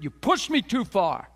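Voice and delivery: high, loud and slow, accusing someone of pushing you too far; a hammed-up blowup, loud and slow; angry outburst. Person blowing up in anger.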